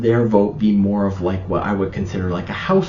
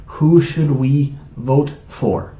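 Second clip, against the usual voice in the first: "Who should we vote for?" slow and higher, word by word; pointed quoted question; 5 semitones higher, much slower than usual. Retold or imitated. Husband asking his wife by letter, quoted slowly and pointedly. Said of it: "He literally asked in the letter".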